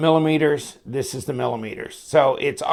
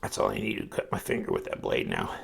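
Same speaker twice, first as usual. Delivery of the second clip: whispered aside; 10 dB quieter, faster than usual. Real, not acted. Muttered aside about cutting finger.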